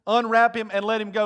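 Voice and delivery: loud, high proclamation; Jesus ordering the grave cloths removed, loud; loud command. Acted. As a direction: proclaim it to the crowd. Preacher at full force.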